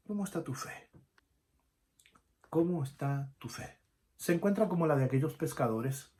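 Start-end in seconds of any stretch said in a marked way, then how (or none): 0.1-0.9 soft question. Acted. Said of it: "repeated question".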